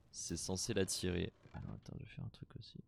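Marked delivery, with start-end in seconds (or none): 1.5-2.8 rapid whispered aside; fast whisper. Real, not acted. Player whispering quickly while crafting. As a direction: rush it under your breath.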